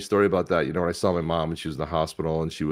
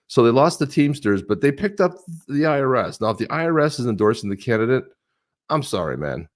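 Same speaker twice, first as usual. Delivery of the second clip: ordinary talk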